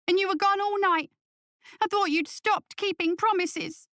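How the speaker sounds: put-on high-pitched child complaining to his dad; young boy. Son continues reproach.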